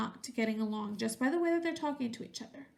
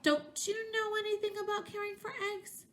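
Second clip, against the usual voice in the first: squealing; 8 semitones higher than usual. A narrator's reading. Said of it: "Duck squealed".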